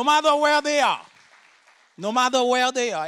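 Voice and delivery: loud, fervent preaching to the church; rousing pulpit sermon. Acted. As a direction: lift the congregation, full voice. Preaching to congregation.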